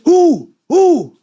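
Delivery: high, loud demanding who; angry demand. Retold or imitated. The buyer demanding who did it, loud and sharp. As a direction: snap the question out hard.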